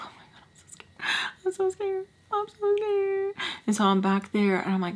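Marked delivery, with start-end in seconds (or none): 0.1-1.2 acted frightened whisper; hushed, panicky mock whisper; scared whisper. Acting out hiding, terrified. 1.4-3.3 tiny, very high scared voice; mock terrified squeak. Acting out hiding, terrified.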